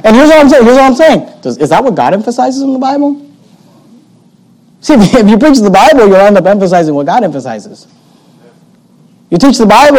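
ordinary talk

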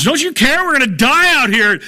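high-pitched frantic mock alarm; acted panic. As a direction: play a panicking disciple waking Jesus. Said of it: "they're all in a panic".

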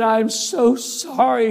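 high, drawn-out pleading; acted-out boy wailing his apology slowly; anguished apology.

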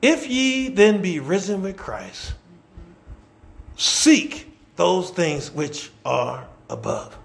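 slow, deliberate scripture reading; read real slow. Shown as a demonstration. Preacher reads the verse real slow so the church sees it. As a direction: lay each word of the verse down slowly. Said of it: "Let me read it to you real slow".

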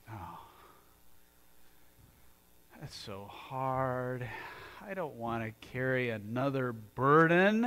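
a slow, drawn-out mock whine; a hint of a weary complaint; whiny complaint. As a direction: sigh it out like a tired complainer. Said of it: "And you're like".

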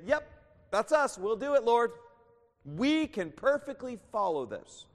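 loud, high, overconfident imitation of the people; mock eager crowd. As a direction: play a crowd volunteering with cocky eagerness. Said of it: "the people say".

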